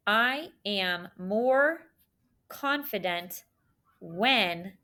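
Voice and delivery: slow, word by word with pauses; dictation. Shown as a demonstration. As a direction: dictate so they can write along. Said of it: "the sentence I want you to start writing".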